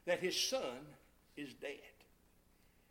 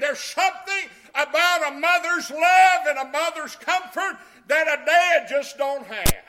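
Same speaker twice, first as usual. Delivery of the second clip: preaching; 6 semitones higher, 18 dB louder than usual. Acted. Preaching at full force.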